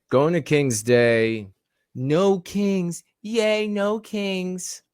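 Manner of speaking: high, slow mocking cheer. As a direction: sneer it out like a giddy child. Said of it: "You see, like, this little fist?".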